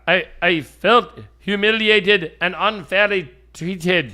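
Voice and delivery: put-on Norwegian accent. Host quoting a traveler denied entry, in a put-on Norwegian accent. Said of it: "in his Norwegian accent".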